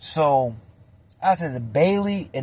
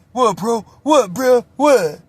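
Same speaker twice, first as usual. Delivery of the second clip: rough challenge; 7 semitones higher, 4 dB louder, slower than usual. Retold or imitated. Homie arriving, confrontational.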